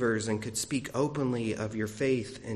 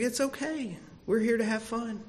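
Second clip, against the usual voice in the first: reassuring; 10 semitones higher than usual. Retold or imitated. Reassuring frustrated daughter while fishing.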